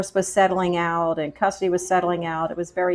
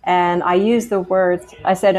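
ordinary delivery

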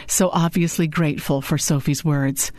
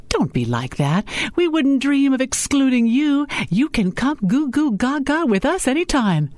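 higher, swooping playful retort; lightly voiced Sophie, teasing; 10 semitones higher than usual. A narrator's reading. Sophie replies without thinking to her boss, playfully teasing. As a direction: tease the boss, pitch lifting after the first words.

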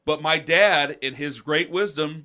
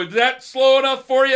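much higher, louder defiant yell; taunting shout; 10 semitones higher, 11 dB louder than usual. Retold or imitated. Teen shouting at grown neighbors from a car. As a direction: shout it out the window to rile them.

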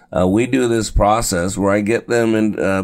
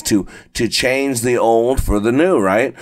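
ordinary delivery

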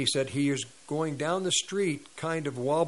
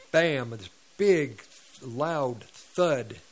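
weighty storyteller's emphasis on "bam" and "thud"; dramatic impact; much slower than usual. Retold or imitated. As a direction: make "bam" land like a falling body. Dramatizing the man's heavy fall.

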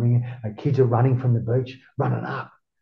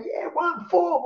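much higher mock crowd cheer; a retold fan shout, pitched up; slower than usual. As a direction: imitate fans calling the band's name. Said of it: "everyone's screaming out".

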